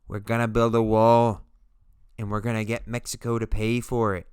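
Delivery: loud, brash campaign-rally voice; bad Donald Trump impression. A vlogger doing a mock Trump rally line. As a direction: boom it out like a politician at a rally. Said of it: "It's a really bad Donald Trump impression".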